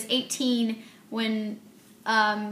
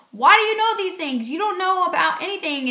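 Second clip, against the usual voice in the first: higher, louder frustrated comeback; exasperated retort; 7 semitones higher, 5 dB louder than usual. Retold or imitated. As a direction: throw it back at her, fed up. Boyfriend snapping back in argument.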